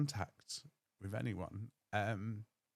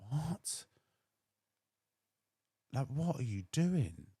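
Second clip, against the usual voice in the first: high "What?" then a slow, louder question; shocked disbelief; 7 semitones higher, 4 dB louder, slower than usual. Retold or imitated. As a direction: reel in shock at the lie. Shocked at dad's false claim.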